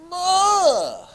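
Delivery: loud, high-pitched groan of frustration; hammed-up exasperated "ugh"; frustrated groan. Voices frustrated Germans.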